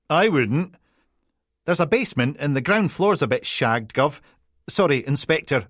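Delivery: high, loud, tumbling Peterhead apologies; flustered Peterhead PC. Acted. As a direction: backpedal fast in broad Peterhead. PC warning about building.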